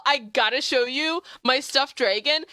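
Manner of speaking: usual speaking voice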